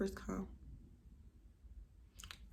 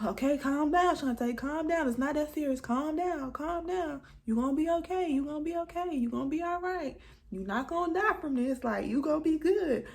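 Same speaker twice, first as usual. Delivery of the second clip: self-soothing pep talk; 7 semitones higher than usual. Retold or imitated. Talking herself out of panic.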